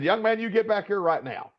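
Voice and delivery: high-pitched playful imitation of a scolding coach; mock-stern coach. Coach jokingly calls back a freshman who skipped his high five, mock-stern. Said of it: "he was being light. He wasn't being serious".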